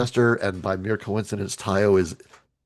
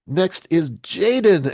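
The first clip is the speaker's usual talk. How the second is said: ordinary delivery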